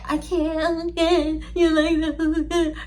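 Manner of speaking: high, drawn-out fake moaning; faked pleasure. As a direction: moan it slowly, obviously putting it on. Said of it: "Just faking it".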